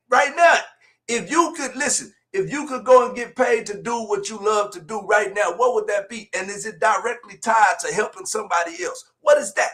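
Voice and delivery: usual speaking voice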